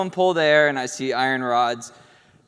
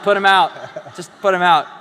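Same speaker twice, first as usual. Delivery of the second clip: loud, high-pitched mock order; exasperated mom; 4 semitones higher, 8 dB louder than usual. Mom ordering doctor to sedate son, exasperated. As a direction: imitate a fed-up mother laying down the law.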